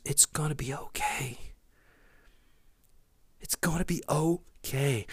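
very soft, hushed reassurance; reassuring whisper. Acted. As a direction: lean in close and whisper comfort. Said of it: "someone leans in and whispers".

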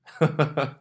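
loud high laugh; burst of laughter. Real, not acted. Laughing at hate mail joke.

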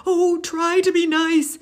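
high, loud, frightened plea; a lion begging not to be hurt; high, loud whimpering plea; scared lion. Acted. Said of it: "he whimpered".

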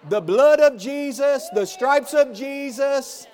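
laughing shout. Real, not acted. Laughing while shouting blessing.

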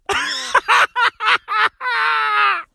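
high cackling laugh; long high laughing fit; real laughter. Real, not acted. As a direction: burst out laughing at your own joke. Laughs at own joke.

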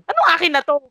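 lightly acted irritation; retold indignant retort. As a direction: throw the words back, annoyed. Retold quarrel over the call.

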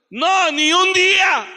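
slow, high, loud shout; emphatic shout. Real, not acted. Preacher insisting God allowed no mourning, shouting slowly. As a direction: hammer each word home. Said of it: "repeating his point".

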